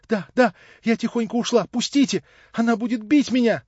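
high, loud panicked pleading; frightened cry. A narrator's reading. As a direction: cry out to be let go, terrified. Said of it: "закричала она".

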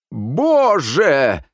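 high, loud, drawn-out cry; startled exclamation. A narrator's reading. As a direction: cry out in theatrical dismay. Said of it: "воскликнул Лео". (потрясённо воскликнуть)

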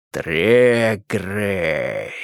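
slow low menacing stretch; sinister drawl. Acted. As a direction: draw the name out like a threat. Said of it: "зловеще протянул Карпов".

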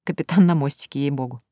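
ordinary delivery, nothing marked

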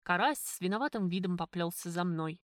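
usual speaking voice

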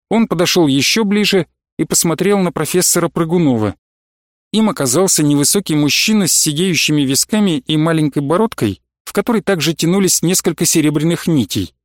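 plain reading voice

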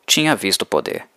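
ordinary delivery, nothing marked

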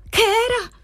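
very high-pitched cry of alarm; startled cry. A narrator's reading. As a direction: cry out in sudden disbelief, voice leaping up. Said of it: "gridò ella".

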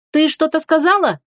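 high-pitched questioning call from off to the side; mild shout to someone out of view. A narrator's reading. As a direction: call over a question, voice lifted. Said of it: "крикнула откуда-то со стороны".

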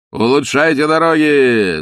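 loud, slow parting call; farewell shout. A narrator's reading. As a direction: call it out grandly from the car. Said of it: "закричал он на прощание".

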